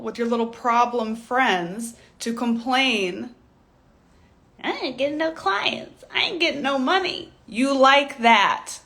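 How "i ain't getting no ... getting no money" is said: mock whining. Mocking complaining problem friends.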